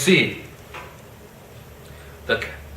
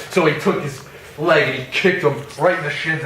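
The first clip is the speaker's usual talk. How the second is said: normal talking voice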